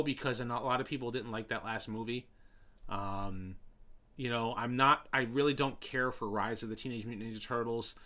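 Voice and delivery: normal talking voice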